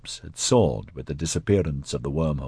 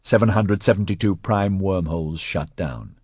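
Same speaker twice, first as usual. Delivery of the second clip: plain reading voice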